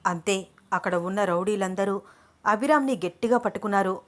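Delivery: plain reading voice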